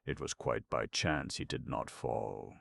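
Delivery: ordinary delivery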